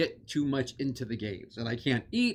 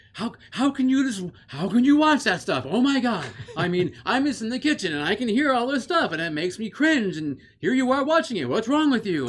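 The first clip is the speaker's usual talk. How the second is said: high, loud, shocked rant; whiny outraged scolding; exasperated son; 9 semitones higher, 5 dB louder than usual. Retold or imitated. Son berating mom over horror.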